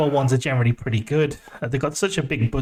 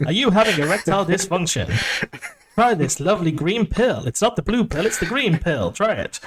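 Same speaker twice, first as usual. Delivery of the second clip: slightly raised, swooping sales pitch; hammed-up TV advert voiceover; spoof drug advert; 3 semitones higher than usual. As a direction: sell it like a cheesy commercial. Spoofing a cheap medicine advert.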